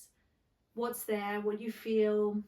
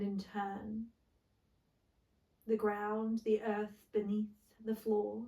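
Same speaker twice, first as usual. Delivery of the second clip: guided meditation. Acted. Yoga teacher settling listeners into the floor, guided meditation.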